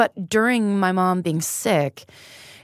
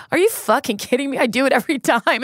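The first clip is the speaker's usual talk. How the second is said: higher-pitched retold disbelief; mock incredulous; 5 semitones higher, faster than usual. Retelling sister's reply to her guilt, incredulous. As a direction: play the sister's emphatic disbelief.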